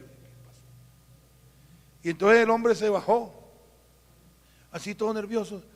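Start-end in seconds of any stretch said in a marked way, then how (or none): 0.0-0.6 hushed whispered question. Retold or imitated. Pastor whispering to the nervous driver at the door. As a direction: whisper it to the stranger.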